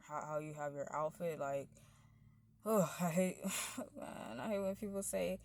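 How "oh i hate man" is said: ordinary delivery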